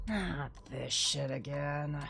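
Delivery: ordinary talk, nothing marked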